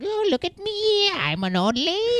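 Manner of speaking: put-on high, slow old woman; hammed-up granny; old lady voice. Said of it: "he sings a whole song where he's like".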